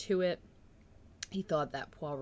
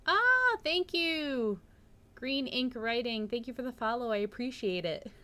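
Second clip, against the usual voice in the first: much higher and louder, delighted thank-you to a new follower; excited thanks; 7 semitones higher, 7 dB louder than usual. Real, not acted.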